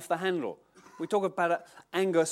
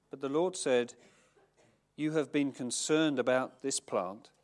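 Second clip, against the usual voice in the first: measured, lowered reading voice; scripture reading; 4 semitones lower than usual. Acted. Preacher reading scripture to the congregation, measured. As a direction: read the passage solemnly. Reads the Jonah passage aloud.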